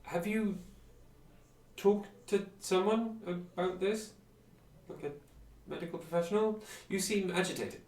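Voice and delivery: high, loud, careful questioning voice; tactful concern. Acted. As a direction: suggest help gingerly, pitched well up and emphatic. Acted reply to paranoid character.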